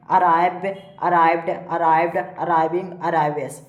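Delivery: evenly spaced list, firm first word; verb drill recitation. Shown as a demonstration. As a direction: recite each form separately for pupils to memorise. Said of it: "इसको इस प्रकार से पढ़ेंगे".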